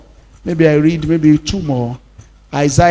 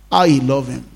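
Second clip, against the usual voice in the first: ordinary talk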